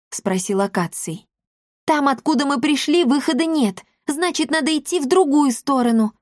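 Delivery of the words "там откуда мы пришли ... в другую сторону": put-on higher-pitched girl making her reasoning clear; high, matter-of-fact girl's voice; explaining girl. A narrator's reading. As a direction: explain it in a raised girlish voice. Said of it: "разъяснила Дарина".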